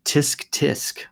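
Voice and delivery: a light mock-scolding tsk tsk; tut-tut like a teacher wagging a finger. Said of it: "wag her finger at me and say".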